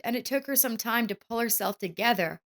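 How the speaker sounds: plain reading voice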